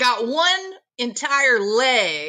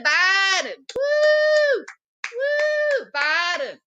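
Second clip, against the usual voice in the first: mock rally cheering; 5 semitones higher, much slower than usual. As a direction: cheer alone like a sparse crowd member. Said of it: "That's what his rally sounded like".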